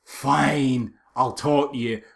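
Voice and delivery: usual speaking voice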